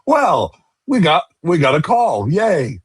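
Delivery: high, drawn-out happy singsong; pleased smile. Retold or imitated. Fan reacting as the referee points to the spot, pleased. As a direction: say it high and slow through a smile. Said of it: "got a smile on my face".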